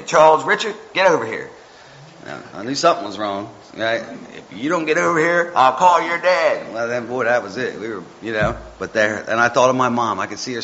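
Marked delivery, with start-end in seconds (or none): 0.0-1.5 mother calling her son over, sharp; mom's call. Retold or imitated. Mom calling her son across the street, raised. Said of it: "My mom would yell at me". 4.4-6.7 higher, louder mom's warning, slowed at the end; mom's threat. Retold or imitated. Mom warning her son she'll fetch dad, higher. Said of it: "My mom would yell at me".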